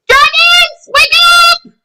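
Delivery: high, loud wake-up call. Pretend. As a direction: shout it up to the sleeping dragons. Calling dragons awake in story.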